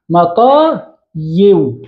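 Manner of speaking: مطايو drawn out slowly, ending in a clear -yu; original underlying form. Shown as a demonstration. Teacher showing the original form of the plural, slow sounding-out. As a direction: say مطايو slowly in two parts, sounding the waw. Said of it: "هذا هو الأصل".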